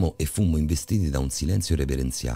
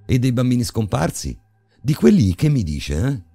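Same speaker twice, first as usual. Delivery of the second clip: higher and louder, pushing the librarian; student pressing the librarian, voice climbing higher and louder; raised voice; 9 semitones higher, 7 dB louder than usual. Acted. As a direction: voice rising without noticing. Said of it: "senza essermi accorto di aver alzato il tono".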